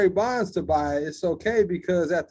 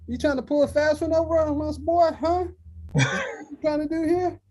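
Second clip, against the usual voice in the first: higher and mock stern, a put-on authority figure; mock corporate boss; 10 semitones higher than usual. Mocking how corporate America talks down to Bonds, in a high put-on voice. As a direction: lay into him like a condescending boss. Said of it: "corporate America type thing where it's like".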